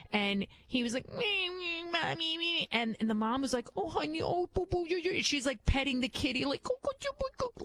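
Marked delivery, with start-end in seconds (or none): 1.1-2.7 put-on high, whimpering child's cry; a kid crying for his mother, much higher; imitating a crying boy. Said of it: "This little boy was crying". 3.8-5.2 put-on high, cooing baby talk; mock soothing mom. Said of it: "like, calm him down". 6.6-7.6 very high, soft repeated cooing; mock shushing coo. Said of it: "like, calm him down".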